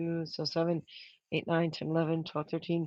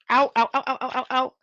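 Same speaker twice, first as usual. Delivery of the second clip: loud, high cries of ow; burned fingers, yelping ow; real pain yelps; 7 semitones higher, 8 dB louder than usual. Real, not acted. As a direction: yelp as the hot glue burns your fingers. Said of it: "that really hurt".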